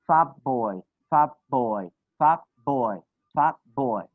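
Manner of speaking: put-on "fat boy" as "fab boy", falling tune; changed t sound. Shown as a demonstration. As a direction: drill "fat boy" with a lip stop for t. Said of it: "So you will say what?".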